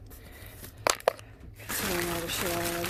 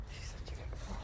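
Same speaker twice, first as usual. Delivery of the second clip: soft whispered praise; 15 dB quieter, much faster than usual. Real, not acted. Praising her good girl in a whisper. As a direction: breathe it tenderly.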